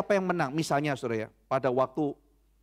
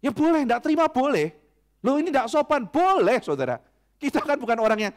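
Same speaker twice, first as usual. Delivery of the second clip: higher, louder emphatic preaching; preaching at full force; 7 semitones higher, 6 dB louder than usual. Real, not acted. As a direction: drive the point home to the church. Preacher presses his point hard. (khotbah berapi-api, tinggi dan keras)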